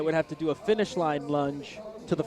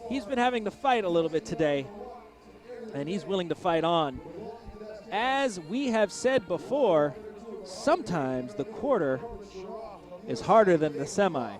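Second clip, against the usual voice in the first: play-by-play call; 3 semitones higher, 3 dB louder than usual. Acted. Live race commentary.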